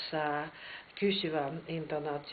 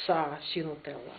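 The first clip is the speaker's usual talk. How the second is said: usual speaking voice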